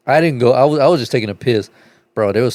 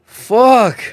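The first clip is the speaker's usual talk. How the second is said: high, loud strained curse; a put-on pained yelp; mock pained curse; 10 semitones higher, 5 dB louder than usual. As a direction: bark it like it hurts. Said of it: "He was like".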